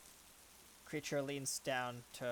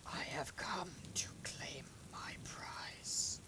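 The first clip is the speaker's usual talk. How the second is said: slow, breathy-free eerie whisper of a sky god; whispered creature voice; slower than usual. Acted. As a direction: whisper it slowly, like a storm spirit claiming a prize. Storm creature makes its entrance.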